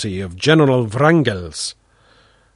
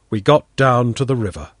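ordinary delivery

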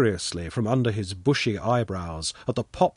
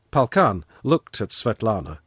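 plain reading voice

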